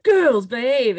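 hammed-up stern parent; mock scold. Echoing a father scolding his girls, mock stern.

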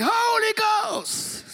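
house-shaking shout. Retold or imitated. Dad proclaiming in church, booming "Holy Ghost" shout. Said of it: "he would have shook the house".

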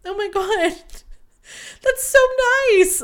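very high, breaking into a laugh; giddy squeal. Retold or imitated. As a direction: squeal and giggle like a swooning teen. Reacting to cheek-kiss request.

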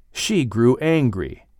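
ordinary delivery

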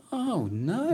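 high, put-on cry of dismay; hammed-up helpless onlooker; mock dismay. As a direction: wail it lightly, pitched right up. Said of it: "they all sit there and go".

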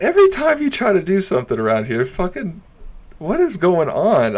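high-pitched mock despair; exasperated outburst. Comic retelling his onstage complaint, exasperated.

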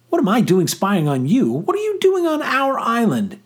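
high, loud indignant comeback; angry retort. A narrator's reading. Boy caught spying turns on the girl, angry retort. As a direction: throw the question back, pitch climbing. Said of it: "embarrassment giving way to anger".

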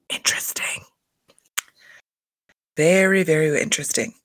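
whispered and knowing; sarcastic whisper. Real, not acted. Reacting to Mary's hypocrisy with a sarcastic whisper. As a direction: breathe it out, loaded with sarcasm.

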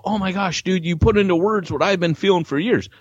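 thrilled reader's praise; excited fan. Retold or imitated. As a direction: gush like a grateful reader. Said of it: "that's where people go".

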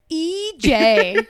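high, loud mocking E J; mocking disbelief. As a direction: scoff at the name. Mocking baby name.

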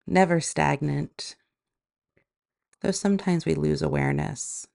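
calm, even meditation voice with long pauses; guided meditation. Acted. Yoga teacher guiding listeners into body and breath, guided meditation. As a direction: guide them gently inward, leaving space between phrases.

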